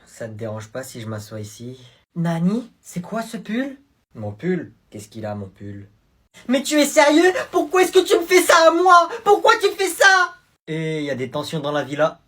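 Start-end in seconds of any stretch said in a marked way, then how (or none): 6.5-10.3 high, loud wailing complaint; hammed-up upset girlfriend. Girlfriend upset at her boyfriend's sweater, high mock wail.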